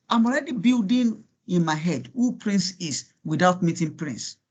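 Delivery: ordinary talk, nothing marked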